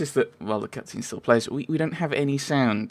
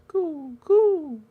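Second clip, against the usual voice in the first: high cooing pigeon noises; playful pigeon impression. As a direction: coo like a pigeon, rising. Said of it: "they were pigeons".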